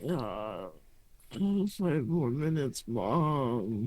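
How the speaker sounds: low, sleepy husband mumbling; groggy half-asleep mumble. Acted.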